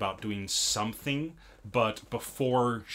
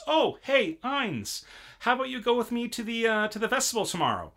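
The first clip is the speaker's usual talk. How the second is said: high, bright mock cheery invite; Loki's voice; 12 semitones higher than usual. Loki sweetly inviting Ainz to the festival, high mock cheer. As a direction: sing it up high like a pushy goddess. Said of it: "Loki comes in and says".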